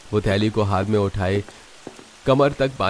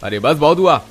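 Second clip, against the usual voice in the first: loud, high, forceful scolding; indignant boss putting accusers in their place; angry rebuke; 4 semitones higher, 6 dB louder than usual. Acted. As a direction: shout the mob down. Employer halts mob and rebukes them.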